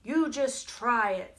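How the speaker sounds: slow, very loud goat dare; loud, mean goat. Acted. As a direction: dare the troll, drawing it out loudly. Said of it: "louder and meaner than the troll's voice".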